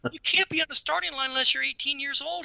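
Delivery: high-pitched mock reprimand; retold scolding. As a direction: imitate an official laying down the rule. Retold official scolding a kid.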